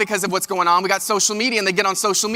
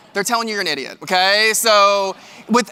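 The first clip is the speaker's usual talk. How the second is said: normal talking voice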